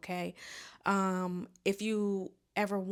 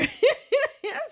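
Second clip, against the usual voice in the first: loud high laugh; 9 dB louder than usual. Real, not acted. Laughs at the story.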